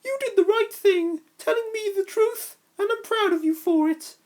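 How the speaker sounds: high tender mother voice with a catch; proud foster mom. Acted. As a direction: tell him you're proud, near tears. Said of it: "his foster mom smiled".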